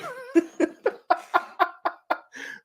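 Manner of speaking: a high, squealing burst of laughter. Real, not acted. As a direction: let out a high laugh at yourself. Laughs at himself.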